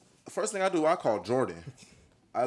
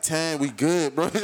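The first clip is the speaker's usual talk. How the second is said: usual speaking voice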